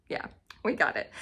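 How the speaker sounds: quick whispered aside to herself. Real, not acted. To herself after finally saying the author's name, a quick whisper. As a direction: breathe it out, half to herself.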